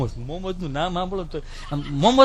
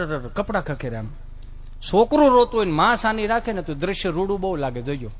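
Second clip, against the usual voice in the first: ordinary delivery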